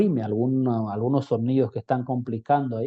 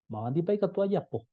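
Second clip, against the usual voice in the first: raised pitch with a falling close on "pa"; model intonation; 7 semitones higher, faster than usual. Shown as a demonstration. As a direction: sing "Mabandi pa ikatu ayapo" high, drop on "pa". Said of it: "Ese sería el tono".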